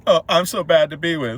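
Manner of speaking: high, loud whiny mockery of the boyfriend; mock self-pity. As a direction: whine it like a pathetic guy.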